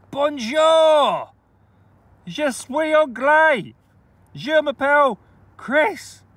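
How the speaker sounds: loud, high, slow schoolboy French; hamming up French to the coin; mock French greeting. Playful French phrases over coin.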